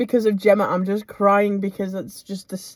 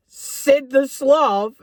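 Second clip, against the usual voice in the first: slow, high, drawn-out incredulous question; higher, louder imitation of being baffled; retold disbelief; 4 semitones higher, 5 dB louder, much slower than usual. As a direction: stretch it out in mock outrage. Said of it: "And I was like".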